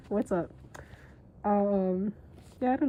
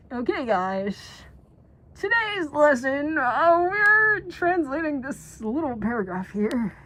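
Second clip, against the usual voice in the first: mock teacher; 4 semitones higher, 5 dB louder than usual. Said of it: "I didn't be like".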